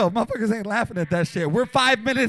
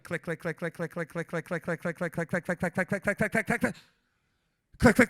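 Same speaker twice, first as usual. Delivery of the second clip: camera clicks; 6 semitones lower, 6 dB quieter than usual. Retold or imitated.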